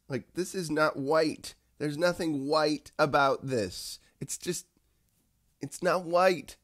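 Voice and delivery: high-pitched, drawn-out mock protest. Mock protest about own skin colour.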